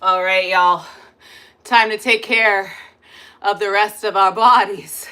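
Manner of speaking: loud, high, pumped-up instructor calls; hype coaching. Acted. Instructor pumping up her workout class, loud hype. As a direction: fire up the class like a spin coach.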